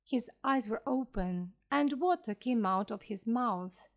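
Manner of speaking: usual speaking voice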